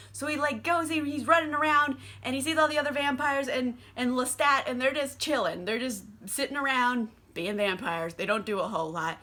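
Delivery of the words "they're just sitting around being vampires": ordinary delivery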